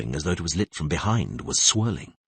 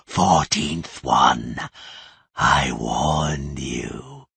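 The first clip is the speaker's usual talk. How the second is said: cold mocking voice; 5 dB louder, much slower than usual. The possessing voice mocking Tris, cold and hostile. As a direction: threaten her with cruel scorn. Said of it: "Her voice became metallic, cold and hostile".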